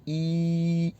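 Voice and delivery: ई held long and steady. Shown as a demonstration. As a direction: draw ई out as a pure long ee. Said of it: "vowel sound क्या निकली". (लंबी बड़ी ई)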